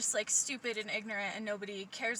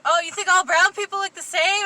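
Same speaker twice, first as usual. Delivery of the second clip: loud, higher mock offence; mock accusation; 8 semitones higher, 13 dB louder than usual. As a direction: imitate a coworker acting offended, loudly. Imitates coworker's mock accusation.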